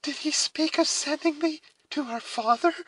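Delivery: very high, anxious hopeful question; trembling voice. A narrator's reading. Alice asking about being sent to father, trembling. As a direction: ask timidly in a high voice. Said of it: "the trembling voice of Alice".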